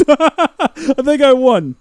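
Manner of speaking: high laughing claim of victory. Real, not acted. Streamer laughing at chat about winning. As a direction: giggle it out slowly.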